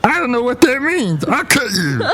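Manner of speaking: high, silly mock threat; comic visitor. Visitor threatening the co-host, high silly voice. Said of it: "This guy".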